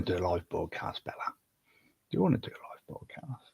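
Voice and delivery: a soft unvoiced whisper to the cat. Real, not acted. Owner whispering to his cat about going live, whispered. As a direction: hush it right at the cat's ear.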